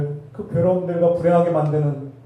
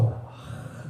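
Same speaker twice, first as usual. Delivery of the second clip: exasperated whispered sigh; 9 dB quieter, much slower than usual. Pretend. Acting out wanting to curse.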